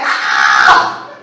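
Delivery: loud, wild preacher yells; screaming pastor. Retold or imitated. Eccentric pastor screaming at his congregation. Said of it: "like to shuffle and scream".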